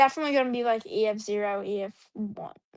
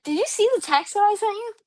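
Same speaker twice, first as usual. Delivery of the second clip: excited retelling; 9 semitones higher than usual. Retold or imitated. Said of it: "I'm like".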